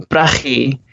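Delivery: "brechi" with a rasping ch in the throat, as in loch; Scouse pronunciation. Shown as a demonstration. Answering the hosts with his first Scouse word, "brechi" with a throaty ch. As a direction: say "brechi" the Liverpool way, ch scraped in the throat. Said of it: "What was your first Scouse word".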